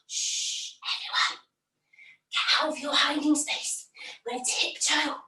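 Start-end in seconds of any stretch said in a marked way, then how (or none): 0.1-1.4 whispered hush to the group. Real, not acted. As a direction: hush everyone while the giant sleeps. Sneaking past sleeping giant.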